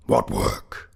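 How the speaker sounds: low, loud goblin voice; demanding goblin. A narrator's reading. Goblin presses the sexton about his work, demanding.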